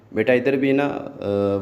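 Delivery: usual speaking voice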